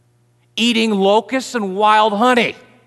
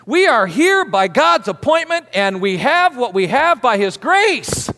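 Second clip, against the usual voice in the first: forceful preaching; 3 semitones higher, 3 dB louder than usual. Acted. Preaching builds.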